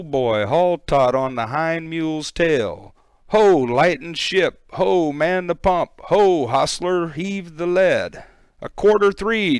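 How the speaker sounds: high, loud bellowed commands; shouted orders. Acted. Crew shouting orders through the storm, frantic. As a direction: roar the orders over the gale. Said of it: "then with a shout".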